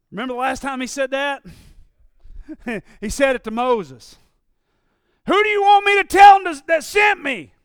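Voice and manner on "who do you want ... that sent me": raised, higher pleading shout; loud question. Retold or imitated. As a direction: call out the question to God. Moses asking God.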